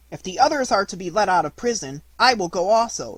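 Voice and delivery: put-on a little wooden boy asking to be freed too; puppet's eager plea. Pinocchio asks the jailer.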